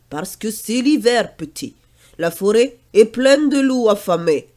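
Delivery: lightly voiced old grandfather, firm; a grandfather warning the boy. Grandfather warns Pierre about hungry wolves, lightly firm.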